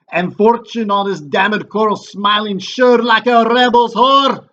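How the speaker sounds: loud, high, hammed-up Scottish captain; terrible Scottish accent. Wounded captain reports the battle to the king, mock Scottish shout. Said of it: "my terrible Scottish accent when I read the part of the captain".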